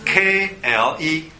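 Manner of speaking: "K L E" slowly, letter by letter; spelling out. Shown as a demonstration. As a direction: dictate "K L E" with each letter held. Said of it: "罗马拼音的话".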